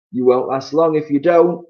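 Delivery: husband grinning at his wife; Mister Twit grinning grislily. Acted. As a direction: threaten with a nasty grin. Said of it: "another grisly grin".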